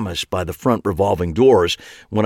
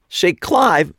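called out, higher and louder; calling after; 11 semitones higher, 4 dB louder than usual. A narrator's reading. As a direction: call after someone walking away. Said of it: "I called after him".

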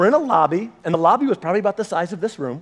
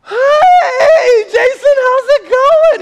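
loud, very high squealed hello; hammed-up frantic greeting; 9 dB louder, much slower than usual. Preacher to friend after catching his baby, comic squeal. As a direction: squeal it loudly in comic falsetto.

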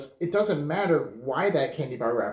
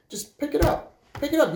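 higher, firm repeated urging; insistent; 9 semitones higher than usual. Real, not acted. Grandson urging everyone to just pick up litter, insistent and higher. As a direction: press the point home. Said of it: "urging the lesson".

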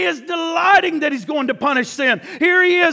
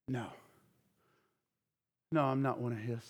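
very soft, much lower, almost under the breath; nervous denial; 12 semitones lower, 16 dB quieter, faster than usual. Acted. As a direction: shrink away and deny it quietly. Peter's frightened first denial.

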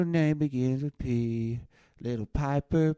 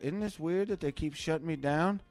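ordinary talk

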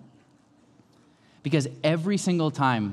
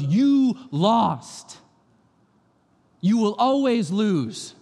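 slow, higher, louder defiance; God taunting; 6 semitones higher, 4 dB louder, much slower than usual. Retold or imitated. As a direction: say it right in the enemy's face. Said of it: "God gets in his face and he goes".

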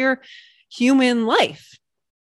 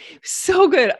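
excited burst; 9 semitones higher, 4 dB louder than usual. Real, not acted.

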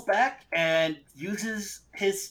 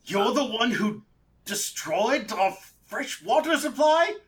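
high, indignant accusation; slow down and pitch up in outrage; outraged captive; 6 semitones higher, 3 dB louder than usual. Acted. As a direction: accuse them in a raised, affronted voice.

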